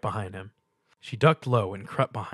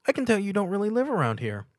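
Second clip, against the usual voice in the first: put-on high, quick pony girl voice; high bubbly pony; 11 semitones higher, 4 dB louder, faster than usual. A narrator's reading. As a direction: chirp it out like a cartoon pony. Pinkie voiced by narrator.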